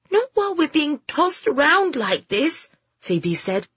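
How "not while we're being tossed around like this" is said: put-on high-pitched girl's objection; fretful youngster's voice; complaining girl. Girl objects while tossed about.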